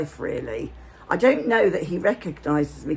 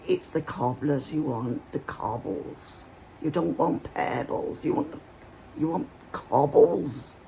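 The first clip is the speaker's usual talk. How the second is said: put-on Hampshire accent; 4 semitones lower, 5 dB quieter than usual. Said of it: "I'm doing his accent as well as I can".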